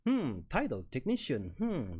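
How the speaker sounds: ordinary delivery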